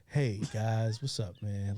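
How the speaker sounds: a hint of the super calm friend; imitating Mikey. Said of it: "Like, this is him".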